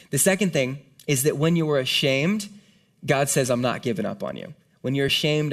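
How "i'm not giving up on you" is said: tender promise. Retold or imitated. God speaking to the ashamed.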